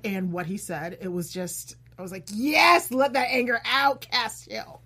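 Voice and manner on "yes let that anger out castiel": retold cheering on. Fan cheering on Castiel's angry kill, excited. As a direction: egg the hero on eagerly.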